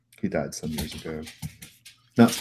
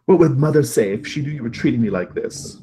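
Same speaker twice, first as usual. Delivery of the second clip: raised, higher-pitched wounded protest; screaming at someone; 7 semitones higher, 9 dB louder than usual. Acted. Spurned brother to the one who shoved him, loud and upset. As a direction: scold loudly, voice pitched up. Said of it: "he kinda screams at you".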